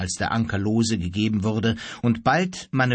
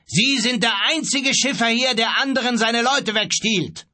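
high, raised-voice accusation; angry shout; 11 semitones higher, 3 dB louder than usual. A narrator's reading. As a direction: shout your accusation across the water. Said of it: "rief er". (wütend rufen)

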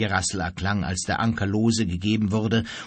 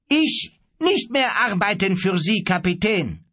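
high, raised voice of open defiance; cheeky, loud; 8 semitones higher, 3 dB louder than usual. A narrator's reading. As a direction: defy the captain cheekily, pitched up. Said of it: "frech und mit lauter Stimme". (frech und mit lauter Stimme)

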